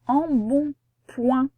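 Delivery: "Embonpoint" slowly split as em, bon, point; slow teaching demo of "Embonpoint", pauses between syllables. Shown as a demonstration. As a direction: separate the three syllables of "Embonpoint". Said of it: "c'est dans la dernière syllabe".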